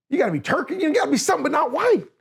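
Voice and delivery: high-pitched, insistent protest; incredulous insistence. Retold or imitated. Student arguing with professor over his nationality, incredulous. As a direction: retell it as high, baffled arguing.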